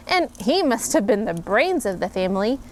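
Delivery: ordinary delivery, nothing marked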